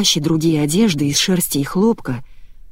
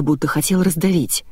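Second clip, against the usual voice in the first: usual speaking voice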